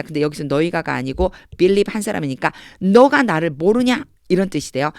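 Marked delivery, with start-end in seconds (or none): 2.8-4.0 challenging question. Shown as a demonstration. Voices Jesus challenging Philip.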